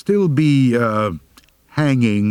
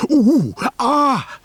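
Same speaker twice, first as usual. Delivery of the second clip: pained groan; 3 dB louder than usual. Acted. Aching rise from hunker, groaning.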